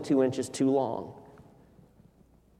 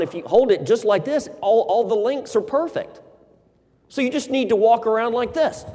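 pushy salesman; 6 semitones higher than usual. Acted. Tailor coaxes unhappy customer to keep the suit, eager pitch. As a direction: sell it hard so he keeps the suit.

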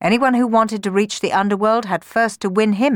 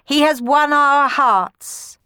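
higher, louder proclamation; raised ceremonial command; 10 semitones higher, 5 dB louder than usual. A narrator's reading. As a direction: Furies announce their verdict, slow at the end. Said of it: "they commanded".

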